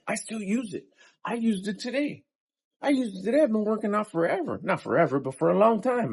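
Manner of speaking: high, eager burst; gushing excitedly about his habit. Real, not acted. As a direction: blurt it out, thrilled. Excited about his own method.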